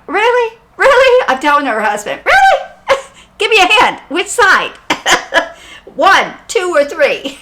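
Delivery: high, loud incredulous "really", laughing through it; half-laughing plea to the spirit for help; excited disbelief. Retold or imitated. Medium telling her husband's spirit, high and laughing.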